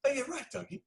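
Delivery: high breezy brush-off; lightly voiced shrugging crew guy; mock casual high voice. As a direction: pitch up, airy dismissal. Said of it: "He goes".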